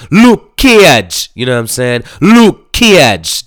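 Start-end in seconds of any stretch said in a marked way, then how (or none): 0.1-1.3 "Luke Cage" in a high, loud Jamaican accent, long drawn Luke. Shown as a demonstration. Showing how Jamaicans say the name, Jamaican accent. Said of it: "I should say it like the Jamaicans". 2.2-3.4 "Luke Cage" in a high, loud Jamaican accent, long drawn Luke. Shown as a demonstration. Showing how Jamaicans say the name, Jamaican accent. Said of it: "That's how they say it".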